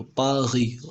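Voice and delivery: "पागी" with the last letter silent; French pronunciation. Shown as a demonstration. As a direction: say "पागी" with a throaty gh for the r. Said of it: "ending letter, वो silent होता है". ("पागी" French ढंग से, गले का ग़)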